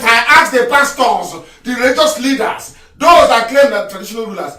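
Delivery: loud, high sermon cry; full-force preacher over music; fiery preaching. Real, not acted. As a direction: preach it hard to the crowd.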